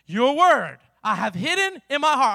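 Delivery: very loud, emphatic verse reading; booming scripture recitation; declaimed scripture. Real, not acted. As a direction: proclaim it in bursts to the room. Reads scripture aloud to congregation.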